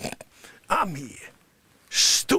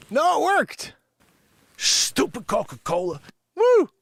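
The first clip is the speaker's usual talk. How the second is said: ordinary talk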